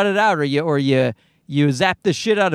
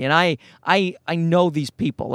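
usual speaking voice

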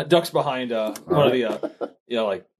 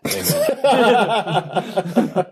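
mock amen; 9 semitones higher, 4 dB louder than usual. Ends mock prayer.

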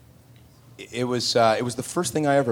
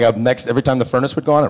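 ordinary delivery

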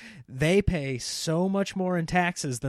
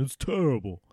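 normal talking voice

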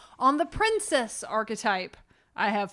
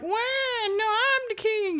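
put-on toddler queen; 11 semitones higher, slower than usual. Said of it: "two-year-old Constance is like".

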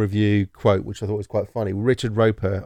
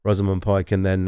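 ordinary talk

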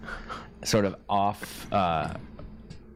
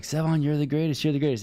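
gushing praise; 7 semitones higher than usual. Retold or imitated. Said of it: "people are like".

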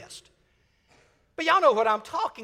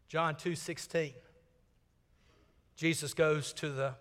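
normal talking voice

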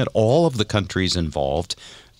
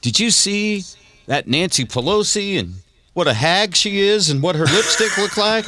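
higher, sneering mock voice; mock a gossiping blowhard; lightly voiced scornful friend; imitating right-wing friend; 10 semitones higher than usual. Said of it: "he'll go".

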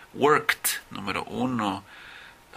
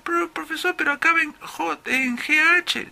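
very high falsetto pupil; objecting student. Acted. Student objects about the spelling to the professor, high put-on student voice. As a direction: put on a squeaky student protest.

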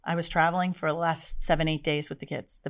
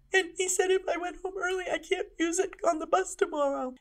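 put-on high tearful boy's voice; crying child; 11 semitones higher than usual. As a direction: voice a sobbing young boy. Son retold while crying.

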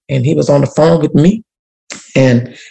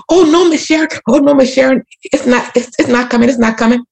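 high and placating, much higher; high backpedal; 9 semitones higher than usual. Retold or imitated. Powerful man on a call backpedals to Miss Sharon, high and placating. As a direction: quickly backpedal to soothe her, pitched way up. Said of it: "changed the, his tune".

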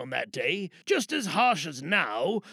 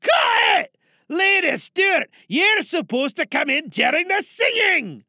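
loud high exasperated shout; director's angry cut; 13 semitones higher, 8 dB louder than usual. Acted. Director yelling at Lady Stewart for missing her cue. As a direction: yell cut at the whole cast. Director stops the take angrily.

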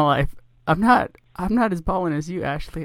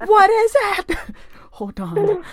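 laughing; 3 dB louder, much slower than usual. Real, not acted. Teasing co-host with fake news.

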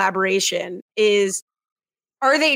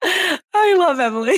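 laughing; 9 semitones higher than usual. Real, not acted. Laughing at text interruption.

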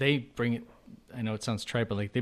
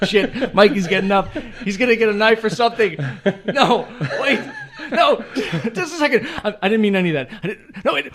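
higher, louder hammed-up panic with laughter; mock panic; 10 semitones higher, 7 dB louder than usual. Guest fakes panic as the host gets up with a knife. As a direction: beg him to stop, laughing. Pretends Mikey comes with knife.

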